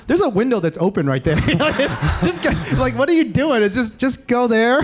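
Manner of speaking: high-pitched, half-laughing retold outburst; urgent mock exasperation. Teammate pointing activists to the open window, mock exasperated. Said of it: "he's like".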